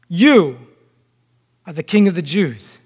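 "You" set apart, punched, then a pause; stress on YOU. Acted. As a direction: hit "You" alone, then drop away on the rest. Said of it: "emphasis on the word you".

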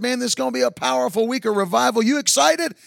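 high and fast, pumping him up; preacher hyping up the confused driver; excited hype. Retold or imitated. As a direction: sell the revival to him.

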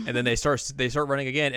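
usual speaking voice

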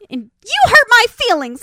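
high, loud mocking whine; mock her hurt feelings, high and loud. Mocking the sitcom woman, high loud whine.

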